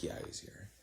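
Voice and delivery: whispered aside to the cop. Retold or imitated. Telling the cop his friend is inside, whispered. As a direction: breathe it, so the friend won't hear.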